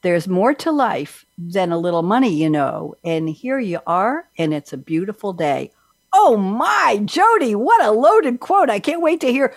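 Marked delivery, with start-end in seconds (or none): 6.1-9.5 wowed, high-pitched exclamation; gushing enthusiasm. Real, not acted. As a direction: react big, stretching the words high and loud. Host gushes over guest's quote.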